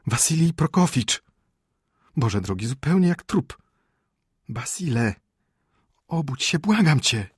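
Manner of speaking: high, loud, urgent pleading; alarmed wife begging; frightened wife. Acted. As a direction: plead in panic with a sleeper to wake up.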